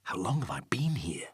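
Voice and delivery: soft unvoiced inner question; whispered thought. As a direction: whisper the worry to yourself.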